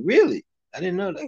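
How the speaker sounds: high-pitched feigned astonishment; mock surprise. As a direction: play innocently amazed, pitch jumping up. Feigning surprise at being corrected.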